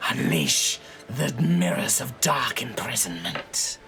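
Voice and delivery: slow, hissing serpent incantation; snake voice. Acted. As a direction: intone it like an ancient snake. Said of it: "in her snake-like voice".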